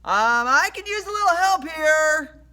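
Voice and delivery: high, loud, pleading call; yell for help. A narrator's reading. Rush begs his horse for help, high yell. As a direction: call urgently for backup, voice pitched high. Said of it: "I yelled, stumbling backward".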